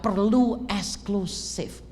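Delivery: perlu eksklusif drawn out very slowly, perlu hit hardest; stressed, drawn out. Real, not acted. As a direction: lean on perlu and stretch both words slowly. Preacher stresses exclusivity point. (menekankan perlu eksklusif dengan pelan)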